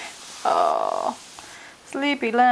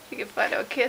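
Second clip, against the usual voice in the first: whispered; 6 semitones lower, 7 dB quieter than usual. Real, not acted. Soft talk to baby.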